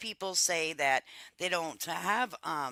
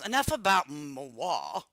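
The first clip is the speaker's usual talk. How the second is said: mock-fancy aside; 5 dB louder, slower than usual. Real, not acted. Playful French word for me.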